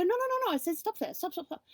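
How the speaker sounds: halting the husband's rant, high giddy protest; stopping him. Retold or imitated. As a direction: cut him off in rising glee, then settle.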